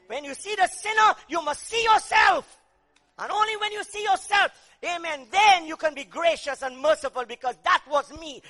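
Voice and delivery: loud, driving sermon cry; fiery preaching. Acted. Preacher shouting to the congregation, loud and driving. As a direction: hammer the power of the cross home.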